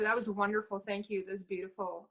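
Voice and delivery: ordinary delivery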